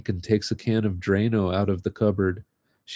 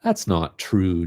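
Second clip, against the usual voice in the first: ordinary delivery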